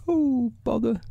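very high bear voice; high storybook bear in trouble; Pooh. A narrator's reading. Pooh stuck in the hole, high fretful voice.

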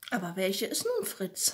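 plain reading voice

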